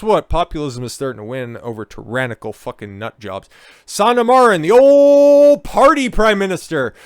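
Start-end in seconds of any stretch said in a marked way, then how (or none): 3.9-6.9 mock announcement. Real, not acted. Sneering intro of politician.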